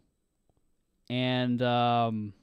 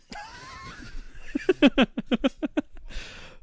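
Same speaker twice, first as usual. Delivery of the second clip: high-pitched laughing fit; long, squealing laugh, very high; burst of laughter. Real, not acted. Hearing Matthew Broderick was considered.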